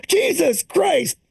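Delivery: put-on jumble the grumbling old man and the lost old lady; in-laws mashup. Both in-laws voiced in a mashup.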